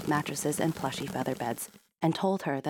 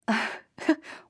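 laugh; 8 semitones higher than usual. A narrator's reading. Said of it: "laughed Princess".

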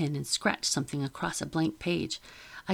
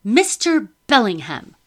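imperious summons; 5 semitones higher, 9 dB louder than usual. A narrator's reading. Lady calling the officer over: loud imperious summons. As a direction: summon him like a servant.

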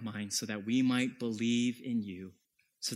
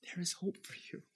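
soft unvoiced whisper of hope; whispered reassurance; 7 dB quieter than usual. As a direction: lean in and whisper the promise. Preacher whispers comfort to listener.

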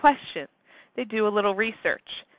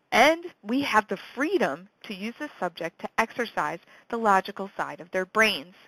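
ordinary talk

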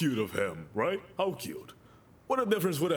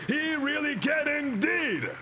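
higher, slow and ringing declaration; preaching shout; 7 semitones higher, slower than usual. Shown as a demonstration. Preacher repeating himself to the congregation, shouting. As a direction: proclaim it to the back row. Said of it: "I said".

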